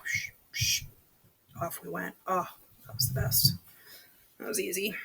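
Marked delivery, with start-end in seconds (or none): none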